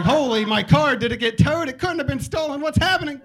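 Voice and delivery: a car owner panicking over a missing car, hammed-up shrieking; mock panic. Stranger searching for their moved car, mock panicked yelling. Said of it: "that turns into panic".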